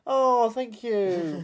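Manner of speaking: hammy overwhelmed winner; mock acceptance speech.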